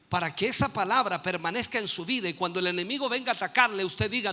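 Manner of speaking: ordinary talk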